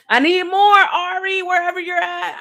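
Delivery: loud, high call to Ari. Real, not acted. Calling out for more coffee.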